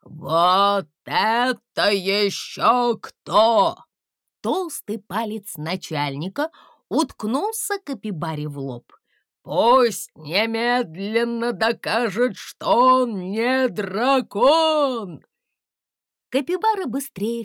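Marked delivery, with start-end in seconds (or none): 0.0-3.8 loud slow commanding guard; gruff chief guard. Acted. Chief of guards looming over. 9.5-15.2 loud slow commanding guard; gruff chief guard. Acted.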